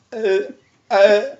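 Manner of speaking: forced grunts in place of words; tongue-tied grunting. Shown as a demonstration. Patient unable to answer caller, strangled grunting. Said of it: "I can't talk".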